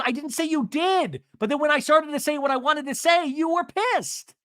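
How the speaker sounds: high, indignant mock arguing; mock-defensive quarrel voice; imitated heated retort. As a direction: argue back high, stretching the last words. Acts out Emily arguing back.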